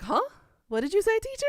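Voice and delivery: high, loud puzzled question; confused students. Retold or imitated. Students baffled by the teacher's Busan dialect, high puzzled imitation.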